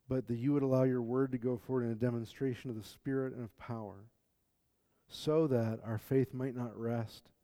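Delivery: soft, humble prayer voice. Acted. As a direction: pray quietly and sincerely. Prayer after sermon reading.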